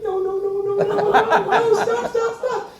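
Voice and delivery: high-pitched string of urgent warnings; re-enacted panic; panicked plea. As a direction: plead in alarm, very high. Pleading with possum heading into traffic.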